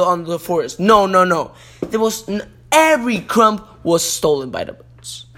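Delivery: ordinary delivery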